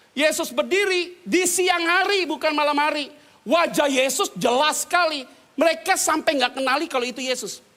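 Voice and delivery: loud, high sermon delivery; full-force preaching. Acted. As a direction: proclaim the scripture to the hall.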